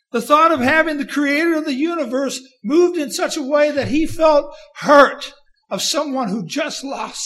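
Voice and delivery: preacher's emotional outcry; impassioned preaching. Acted. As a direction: preach it with a tearful cry. Preaching climax, voice breaking.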